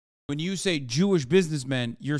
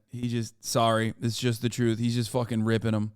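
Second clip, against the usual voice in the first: usual speaking voice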